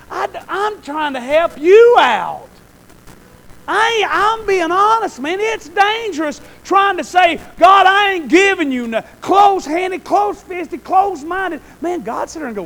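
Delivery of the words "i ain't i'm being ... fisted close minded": loud, high shouted preaching; preaching at full force. Real, not acted. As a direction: shout it to the congregation. Preaching peak.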